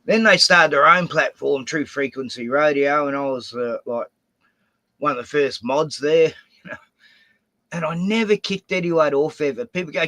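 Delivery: normal talking voice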